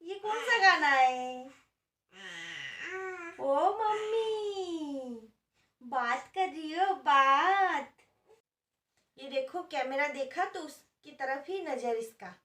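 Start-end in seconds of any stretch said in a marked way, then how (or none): 0.0-7.9 high, slow sing-song cooing. Real, not acted. Mother coos to her baby about the song she's making, slow high baby talk. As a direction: draw the words out sweetly for an infant.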